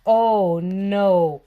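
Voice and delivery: normal talking voice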